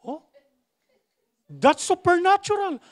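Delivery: ordinary delivery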